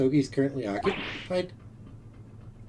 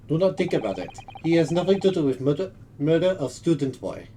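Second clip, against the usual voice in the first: put-on Russian accent; 2 semitones higher than usual. Plays Russian sailor.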